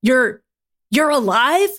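falter, then ask in disbelief; genuine shock. A narrator's reading. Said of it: "said in genuine shock".

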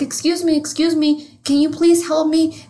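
put-on high, small girl's voice asking for help; mock Lisa Simpson asking directions, high; little girl voice. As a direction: pitch up like a lost little girl. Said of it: "And she's like".

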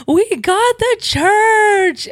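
high, drawn-out mock wail; retold bawling. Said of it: "I just started bawling".

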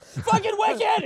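high, slow angry outburst; hammed-up frustrated shout; mock yell. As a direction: yell it like Flash just crashed. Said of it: "you're like".

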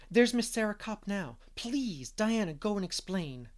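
flustered urgent whisper-shout; urgent alarm. Acted. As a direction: Anne spotting the owner arriving. Anne spots Miss Kopp.

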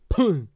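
sharp mock spit noise; spitting sound. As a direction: spit the word out. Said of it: "says the words".

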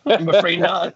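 laughing through the words. Real, not acted. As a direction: chuckle while answering. Laughing reply to a joke.

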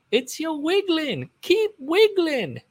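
very high, slow, stretched repeat of the bandmate; high drawn-out mock voice. Retelling the bandmate's line to the audience, very high and slow. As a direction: float it up high and slow, mocking. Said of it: "I don't think that happened in real life".

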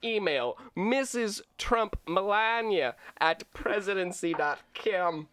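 hammed-up Russian video game villain; cheesy Russian bad-guy accent. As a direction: read it like an eighties-movie Russian bad guy. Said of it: "Russian video game bad guy".

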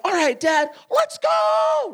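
put-on high, excited kid's voice, jumping to a shout; an eager little girl saying yes; little daughter voice. Dad voices young daughter agreeing.

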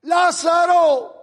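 grito lento y potente, llamando a un muerto; llámalo con toda autoridad, alargando el nombre; grito fuerte. Acted. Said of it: "Le gritó". (un grito lento de autoridad)

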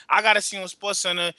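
ordinary talk, nothing marked

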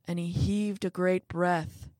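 ordinary delivery

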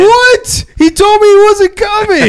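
high, loud, stunned outcry; shocked shout. Real, not acted. Friend walks in unannounced, high shocked shout. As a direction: yell it in disbelief.

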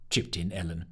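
usual speaking voice

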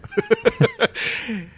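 loud, high laughing fit; high burst of laughter. Real, not acted. Big laugh after Tinder joke.

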